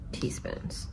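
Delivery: usual speaking voice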